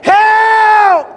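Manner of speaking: high, drawn-out desperate shout; screamed cry for help. Acted. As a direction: cry out to Jesus at full voice. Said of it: "I don't need to scream so loud".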